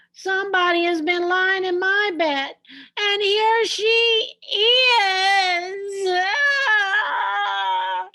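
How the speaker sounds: the cub crying out at finding Goldilocks; little wee bear crying out. Acted. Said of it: "cried the little wee bear in his little wee voice".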